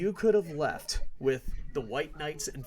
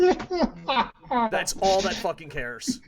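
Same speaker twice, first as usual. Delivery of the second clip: slow, loud, higher rant; ranting shout; 6 semitones higher, 7 dB louder, much slower than usual. Real, not acted. Podcaster ranting about fans, slow loud shout. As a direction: spit it out slowly in disgust.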